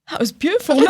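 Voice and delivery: high-pitched quote of an awestruck compliment; mimicked earnest compliment.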